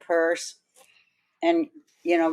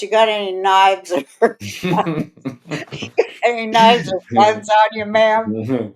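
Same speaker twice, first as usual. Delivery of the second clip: security guard; 4 semitones higher, 3 dB louder than usual. Retold or imitated. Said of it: "He goes".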